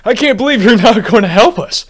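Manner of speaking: loud, high, disbelieving protest; outraged caller. Acted. Caller yelling at the unhelpful operator, outraged and loud.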